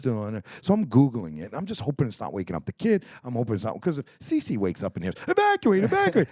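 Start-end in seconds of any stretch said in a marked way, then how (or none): 5.3-6.3 very high, loud alarm imitation; screaming alarm. Man mimics the alarm his family might hear, screaming. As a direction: shriek it like a warning detector. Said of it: "in the background screaming at me".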